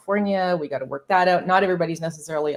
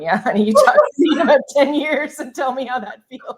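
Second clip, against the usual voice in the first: higher, louder, teasing seasoned-traveler comeback; mock-knowing retort; 8 semitones higher, 7 dB louder than usual. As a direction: knowingly put the excited rookies in their place. Jaded traveler's imagined retort to rookies.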